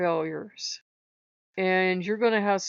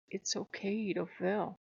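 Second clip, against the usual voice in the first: softly, a hint of a kind old man; gentle old man; 8 dB quieter than usual. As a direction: lean in and say it softly. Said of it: "The old man leans down and says".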